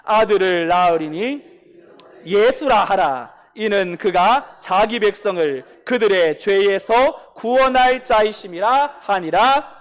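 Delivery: loud, slow solemn reading of Scripture. Real, not acted. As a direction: read it out together, slow and loud. Congregation reads scripture aloud.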